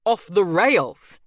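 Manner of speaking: loud, slow disbelieving outburst; Damien's loud angry disbelief; angry cry. A narrator's reading. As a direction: hurl the phrase back at her. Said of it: "he cries".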